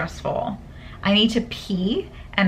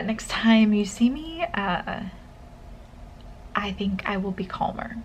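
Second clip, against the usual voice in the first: soft whispered promise; 5 dB quieter than usual. Real, not acted. Student promising viewers to be calmer, whispered. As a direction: whisper it gently, worn out. Signing off tired after exam.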